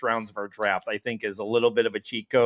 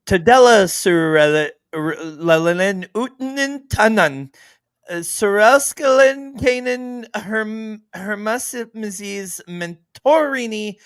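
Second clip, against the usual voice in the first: mock Finnish accent; 8 semitones higher, 6 dB louder than usual. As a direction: ham up Finnish names with too much excitement. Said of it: "Finnish accent all week".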